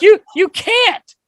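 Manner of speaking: high, slow, loud disbelieving protest; incredulous. Retold or imitated. To his old coach about giving up his card, incredulous. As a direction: stretch it out in shocked disbelief. Retelling argument with Hexy, disbelief.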